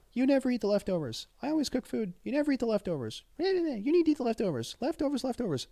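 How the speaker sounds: high-pitched mock nagging wife; whiny high mimicry of a nagging wife; imitating wife. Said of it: "she's like".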